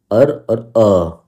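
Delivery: अर then अ, drawn out slowly; err, US and UK. Shown as a demonstration. Teacher contrasting US and UK ways of saying err. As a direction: say अर with r, then अ without it. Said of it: "UK वाली में इसकी pronunciation होती है खाली अ".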